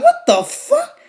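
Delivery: very high, loud incredulous outburst; mock high-pitched disbelief; shocked disbelief. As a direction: squawk it in retold shock. Retold shocked reaction to the friend.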